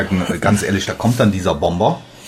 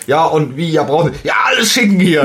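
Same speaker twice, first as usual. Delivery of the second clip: shouting order; 6 semitones higher than usual. Retold or imitated. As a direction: bellow for every gun available. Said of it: "der ruft dann ... und sagt".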